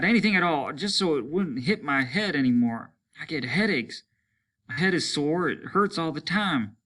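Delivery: a man at the end of his tether; complaining soldier. Acted. Soaked soldier rants to comrades, high frayed complaint.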